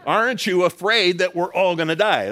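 loud, high panicked plea; frightened disciples yelling over the storm; desperate cry. Retold or imitated. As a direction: shout it like frightened fishermen in a storm. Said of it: "That's what they're really asking him".

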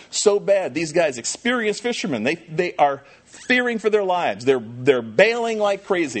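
high, loud storytelling sermon; preacher at full cry; impassioned preaching. Acted. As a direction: drive the storm story home. Pastor preaching the storm story.

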